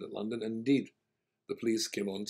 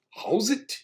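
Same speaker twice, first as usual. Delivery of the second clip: South African reply; 12 semitones higher, 10 dB louder than usual. Retold or imitated. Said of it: "he looked up and he said".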